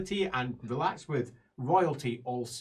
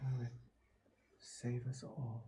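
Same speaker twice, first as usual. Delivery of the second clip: breathy whispered plea, slow; despairing whisper; 4 semitones lower, 16 dB quieter, slower than usual. Acted. Elf whispers in dismay at another newcomer, slow and hushed. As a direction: whisper it in dread.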